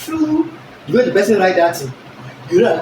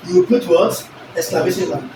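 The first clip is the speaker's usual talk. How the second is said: ordinary delivery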